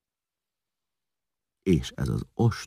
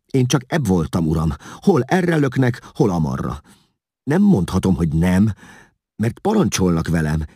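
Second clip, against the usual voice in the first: higher, louder voice of a self-excusing underling; pleading interpreter; 6 semitones higher, 7 dB louder than usual. A narrator's reading. Vigilász excusing himself before the king, high and pleading. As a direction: plead that you were only following orders.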